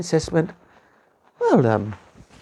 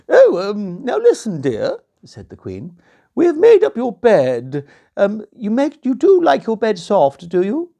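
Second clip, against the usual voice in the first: high, loud sweet-talking queen; 9 semitones higher, 6 dB louder than usual. Acted. Queen asking the princess about her bed, high and coaxing. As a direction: coax her like a scheming queen.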